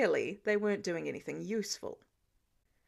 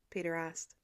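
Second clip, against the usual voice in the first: ordinary delivery, nothing marked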